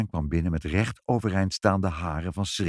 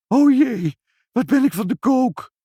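very high, loud squeal of dismay; distraught; 14 semitones higher, 6 dB louder than usual. A narrator's reading. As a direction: wail that it is all too much. Mevrouw upset by the fire. (hoog en luid ontdaan gejammer)

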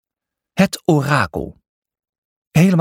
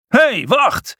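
sharp, high-pitched order to halt; commander's shout; 11 semitones higher than usual. A narrator's reading. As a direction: shout it as a commander stopping intruders. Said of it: "schreeuwde de commandant". (geschreeuwd bevel van de commandant)

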